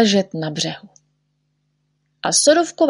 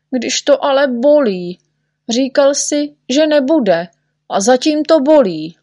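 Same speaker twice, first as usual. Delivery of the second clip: high-pitched aggrieved complaint; high, reproachful whine; pained protest; 6 semitones higher than usual. Acted. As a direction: protest like a sore, cheated patient. Hurt patient reproaches the doctor.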